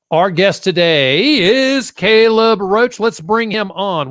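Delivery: show-host introduction. Real, not acted. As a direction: stretch it out like a big entrance. Host announces the guest's entrance.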